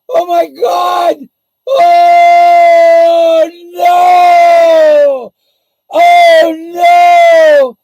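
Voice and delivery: high, loud, wailing self-pity; hammed-up whiny victim shouting to the world; shrieking mock Frank, slowed and drawn out at the end. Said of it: "Now I'm gonna become Frank".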